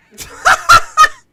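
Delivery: big burst of laughter; loud laughing shout. Real, not acted.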